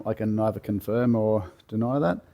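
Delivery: ordinary talk, nothing marked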